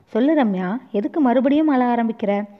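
plain reading voice

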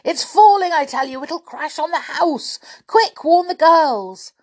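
high-pitched urgent shout; yelling. A narrator's reading. Boy warning his brother of the falling tree, yelling. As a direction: raise the alarm at full tilt. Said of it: "yelled Julian".

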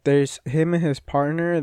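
ordinary talk

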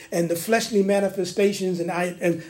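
usual speaking voice

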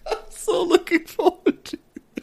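very high, slow squeal; gleeful squeak. Real, not acted. Host anticipating a Cockney accent, squeaky glee. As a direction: squeak it out after laughing.

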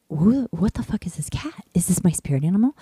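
hushed unvoiced whisper, wide-eyed. Seeing a ghost cat at Sarah's, whispered. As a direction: whisper it in awe. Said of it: "And I was like".

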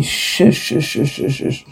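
soft, voiceless string of shushes; urgent hushing. Acted. Hushing someone who calls Angus for help, whispered shushing. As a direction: hush them under your breath.

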